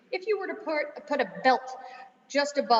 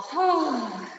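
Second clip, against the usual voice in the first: audible breathy sigh falling away; demo sigh; 3 dB louder than usual. Shown as a demonstration. Teacher showing the class how to sigh, audible sigh. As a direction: let out a long falling sigh.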